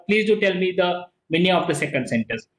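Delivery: ordinary talk, nothing marked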